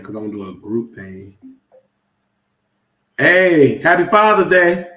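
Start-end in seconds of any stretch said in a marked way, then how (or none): 3.2-4.9 loud happy phone greeting; cheerful loud hello down the phone. Real, not acted. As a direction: call out happy Father's Day.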